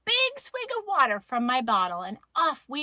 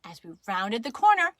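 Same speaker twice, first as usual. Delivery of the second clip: ordinary delivery, nothing marked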